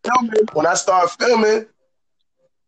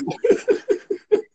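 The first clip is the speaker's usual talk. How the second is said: laugh; 13 semitones higher than usual. Real, not acted. Laughing after "I'm done.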